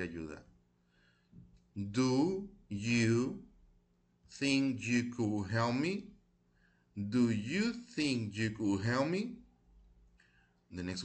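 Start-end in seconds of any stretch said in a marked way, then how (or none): 1.7-6.1 slow model reading. Shown as a demonstration. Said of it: "ya las repetí varias veces". 6.9-9.4 slow model reading. Shown as a demonstration. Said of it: "ya las repetí varias veces".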